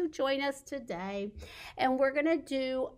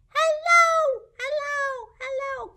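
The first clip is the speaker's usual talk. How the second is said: very high squeaky hellos; 13 semitones higher, 5 dB louder than usual. Shown as a demonstration. Leading babies in saying hello high and squeaky. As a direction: squeak it like a tiny mouse. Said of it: "nice and squeaky".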